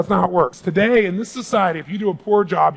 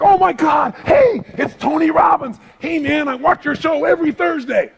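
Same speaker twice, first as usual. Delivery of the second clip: starstruck fan; 8 semitones higher than usual. Retold or imitated. Fan recognising Tony Robbins from TV, starstruck shout. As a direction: play the fan who spots him, all excited.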